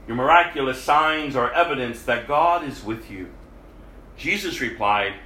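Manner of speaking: scripture reading. Acted. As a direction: read the passage steadily, like scripture aloud. Reads scripture passage aloud.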